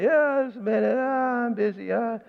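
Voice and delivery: put-on child shrugging off a parent calling their name; brushed-off child. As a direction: play the busy kid who can't be bothered. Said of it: "or say".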